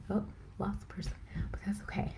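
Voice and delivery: quick, near-whispered aside to self; hushed aside. Real, not acted. Livestreamer noting a lost viewer, hushed aside. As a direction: drop to a soft, whispery undertone.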